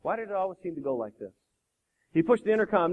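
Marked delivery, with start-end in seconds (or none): 0.0-1.3 soft, weary musing; resigned sigh. Acted. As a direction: sigh it out quietly. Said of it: "He sighed".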